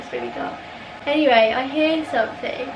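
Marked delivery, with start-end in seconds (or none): 0.0-0.6 much lower, softer dream voice; dreamy mumble. Retold or imitated. Dreamer in a dim dream, low and soft. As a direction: drop it low like half asleep. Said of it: "when you're in a dream and nothing's really bright".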